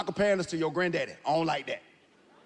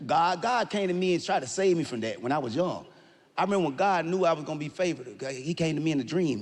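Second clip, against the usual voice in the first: normal talking voice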